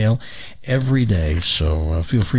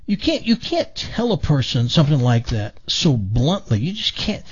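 higher, hurt voice of the upset sister; 6 semitones higher than usual. Acted. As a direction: play the sister, indignant and wounded. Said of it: "She, she becomes hysterical".

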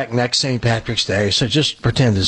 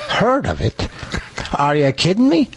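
put-on weak Irish accent; 5 semitones higher than usual. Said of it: "my Irish accent is pathetic".

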